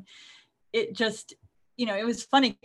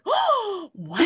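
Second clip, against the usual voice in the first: shocked; 8 semitones higher than usual. Retold or imitated. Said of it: "And I was like".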